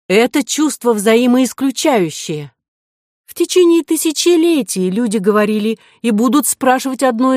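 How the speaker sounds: high-pitched, emphatic lecturing; offended heroine making her case; indignant. A narrator's reading. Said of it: "возмутилась Ариадна".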